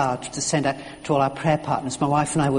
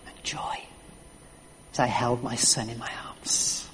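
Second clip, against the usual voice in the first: near whisper, remembering with love; hushed tenderness; 5 semitones lower, 8 dB quieter than usual. Real, not acted. Preacher recalling holding his hurt son, hushed and tender. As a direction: let it fall to a breath.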